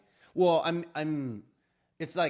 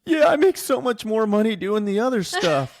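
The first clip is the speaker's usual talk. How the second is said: high, loud, laughing through it; laughing reassurance; 11 semitones higher, 15 dB louder than usual. Retold or imitated. Reassuring his bosses about money, high and laughing. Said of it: "And I was like".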